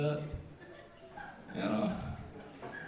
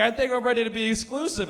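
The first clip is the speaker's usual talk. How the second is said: higher and louder, lightly put-on girlfriend; mock girlfriend voice; 7 semitones higher, 6 dB louder, faster than usual. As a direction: play the eager girlfriend, pitched up. Voicing girlfriend in a story.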